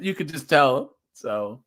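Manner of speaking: normal talking voice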